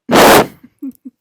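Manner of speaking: unvoiced laughing burst; sudden real laugh; loud burst of laughter. Real, not acted. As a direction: break out laughing. Laughing at the memory.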